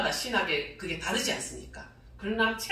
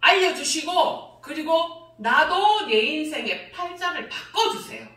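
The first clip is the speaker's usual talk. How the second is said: raised, slow, pleading cry to God; loud pleading prayer; 6 semitones higher, 5 dB louder, slower than usual. Retold or imitated. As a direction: plead loudly and slowly, half laughing on 나도. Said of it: "내가 뭐라고 했어요?". (크고 느리게 간청하는 기도)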